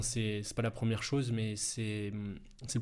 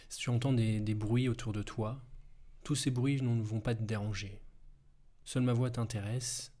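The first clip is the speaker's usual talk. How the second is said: guided hypnosis. Acted. Hypnosis body relaxation.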